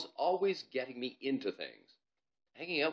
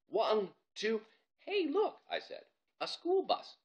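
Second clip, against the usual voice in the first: much higher, excited spotting; surprised boy; 12 semitones higher, slower than usual. Acted. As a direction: stall by pointing. Bob distracted, stalling.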